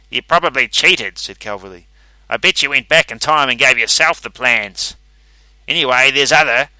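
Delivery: loud, bitter accusation; accusing rival. A narrator's reading. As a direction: jab the accusation at him. Calverley accuses Rankin.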